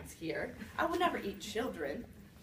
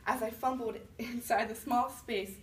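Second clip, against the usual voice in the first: plain reading voice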